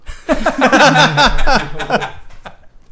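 big high laugh; loud burst of laughter. Real, not acted. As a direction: crack up out loud. Big laugh at keto joke.

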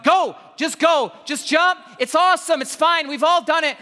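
high, loud, exasperated pep talk; angry urging, retold. Angry dad urges Jerome off the ledge, exasperated shout. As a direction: loudly push a frightened child to jump. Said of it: "I'm angry. I'm like".

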